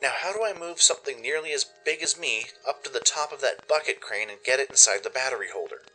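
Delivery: ordinary talk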